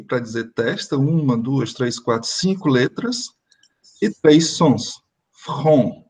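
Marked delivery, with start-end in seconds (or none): 5.3-6.0 front com F, R e ON nasalizado; front, nasal ON. Shown as a demonstration. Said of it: "o som de F, o som de R e o som de ON nasalizado". (front com o ON nasalizado)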